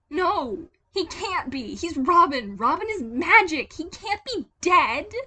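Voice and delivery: high, loud desperate cries; a child refusing the truth; high shouting denial of a boy; young Tim in denial. Acted. Tim refuses Robin's death.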